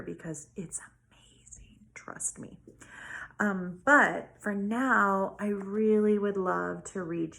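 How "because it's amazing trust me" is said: hushed, secretive whisper; whispered aside. Real, not acted. Teacher whispering to her class, conspiratorial whisper.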